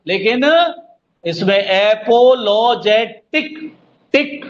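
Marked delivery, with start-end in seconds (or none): 1.6-4.4 apologetic slow by syllable, rising to टिक; spaced अ-पो-लो-जे, emphatic टिक; stress on टिक. Shown as a demonstration. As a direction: punch टिक, then say it alone. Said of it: "जीभ पे जोर पड़ रहा है".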